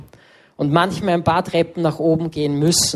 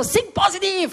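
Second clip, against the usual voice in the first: high, cheesy motivational coach voice; pushy friend; 13 semitones higher than usual. Retold or imitated. Best friend mocking the grumpy motivational trainer, high and hyped. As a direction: bounce it out like an overeager hype coach. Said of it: "er war so".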